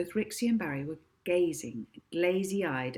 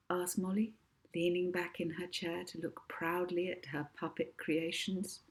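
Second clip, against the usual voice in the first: plain reading voice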